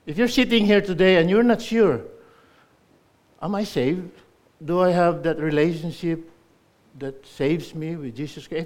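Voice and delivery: loud, high preaching; urgent, pleading sermon; preaching at full force. Acted. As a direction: press the listeners hard. Preacher urges the unsaved.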